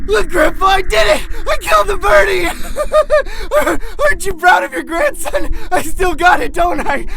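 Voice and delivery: very high, crying, laughing yell; manic triumphant shout. Acted. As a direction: scream with unhinged glee. Manic triumph after shooting.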